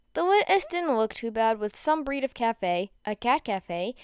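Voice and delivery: usual speaking voice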